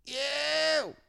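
very high drawn-out whoop; high catchphrase yelp; signature yell. Acted. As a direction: let out a long mock street call. Repeating the man's signature yell.

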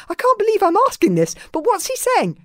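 put-on high, hurried boy's voice; quick, high incredulous question; incredulous friend. A narrator's reading. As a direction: ask in baffled, high-pitched disbelief. Fishlegs asks, incredulous.

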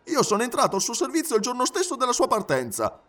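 high-pitched protesting valet; animated high valet's voice; emphatic Passepartout, surprised. Acted. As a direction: explain it eagerly, pitch well up. Passepartout explains to the detective.

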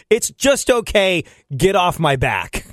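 slow, drawn-out mild irritation; acted fed-up reply, dragging the words; irritated brush-off. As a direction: stretch it out, wanting to be left alone. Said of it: "It sounds like".

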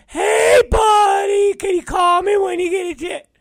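very high, loud squeaky mimicry of a needy buddy; mock him in a shouted falsetto; mocking caller impression. Coworker pestering his boss to call him, high mocking falsetto. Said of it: "Will hits me".

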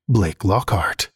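usual speaking voice

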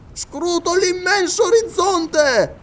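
high excited scholar calling down from a tree; Paganel's voice. Acted. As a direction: play the absent-minded geographer, pleased with himself.

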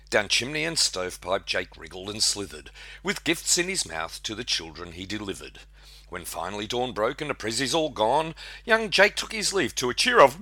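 lively rhyming read-aloud; poem recitation. Acted. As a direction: recite it like a Christmas ballad.

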